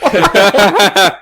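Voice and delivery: high, laughing mock innocent what; mock surprise. Host retells his innocent reply to wife, laughing surprise. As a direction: play dumb, laughing. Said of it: "I'm like".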